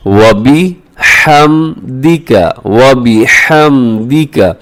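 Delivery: slow, drawn-out Quranic recitation. Shown as a demonstration. Teacher reciting the Sana for students, slow and drawn out. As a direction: chant each syllable slowly. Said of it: "अब यहाँ क्या होता है".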